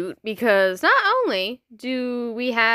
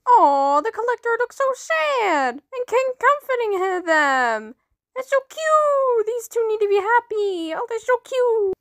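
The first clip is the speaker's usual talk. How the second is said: much higher, cooing aww voice; gushing over cuteness; 11 semitones higher than usual. Real, not acted. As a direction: coo like at a puppy. Gushing over cute characters.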